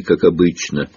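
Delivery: usual speaking voice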